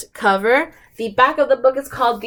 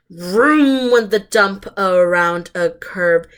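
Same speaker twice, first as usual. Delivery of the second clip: plain reading voice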